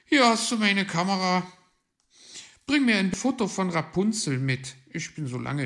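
high, loud, quick request; eager Paul. A narrator's reading. Paul hands over his camera.

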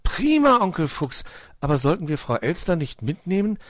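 put-on earnest, concerned child's plea; concerned little fox. A narrator's reading. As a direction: start delighted, then turn mildly worried. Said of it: "meinte Weißohr besorgt".

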